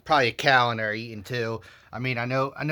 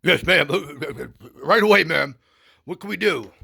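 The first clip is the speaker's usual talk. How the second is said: higher, louder eager guard; 7 semitones higher, 7 dB louder than usual. Retold or imitated. Guards answering the wife, in an eager raised voice. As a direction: snap to it like an obliging guard. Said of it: "and they're like".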